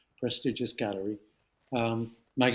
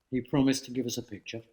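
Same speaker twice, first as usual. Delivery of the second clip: ordinary talk, nothing marked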